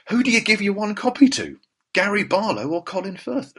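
high-pitched, playful dilemma; mock agonising. Host weighing who gets his one copy, mock agonising. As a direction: pose the big question up high, in mock quandary. Acts out agonising over the choice.

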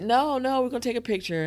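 high-pitched imitation of a reassuring insister; gentle insistence. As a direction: insist in a lifted voice, quoting her. Imitating First Lady's gentle insistence.

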